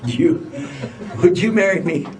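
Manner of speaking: retold halting proposal, rising on the second try; real proposal. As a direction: work up to popping the question after a false start. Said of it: "like a real proposal".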